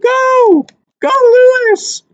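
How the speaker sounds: high, loud cheer of "Go, Lewis"; crowd cheering. Retold or imitated. Crowd cheering on Lewis before the fight, high loud shout. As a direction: yell it like the crowd at ringside. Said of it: "Everybody's like".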